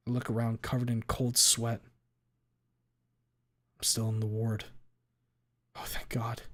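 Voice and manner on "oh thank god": breathless whispered relief; relieved whisper. Real, not acted. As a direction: whisper it, shaken. Relief after waking from nightmare.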